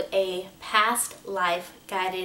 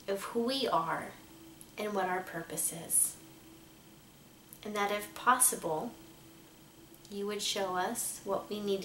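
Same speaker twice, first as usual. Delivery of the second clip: soft, measured meditation voice with long pauses; guided meditation; 7 dB quieter than usual. Acted. Meditation leader asking angels and guides for protection, calm and hushed. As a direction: gently lead listeners into calm, leaving space to breathe.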